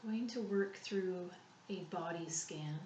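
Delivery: calm, unhurried meditation voice with long pauses; soothing body-scan guide, softening as it goes; guided relaxation. Real, not acted.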